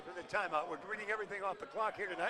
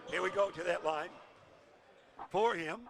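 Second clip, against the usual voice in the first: ordinary delivery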